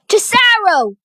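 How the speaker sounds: high, loud squeal; loud, high-pitched shout of a name; squealed cry. A narrator's reading. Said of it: "squealed Sir Andrew".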